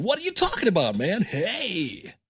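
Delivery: high, swooping goofy put-on voice; cartoonishly different from your real voice; different phone persona. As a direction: greet the caller in an exaggerated high-pitched voice. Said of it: "you sound so different on the phone".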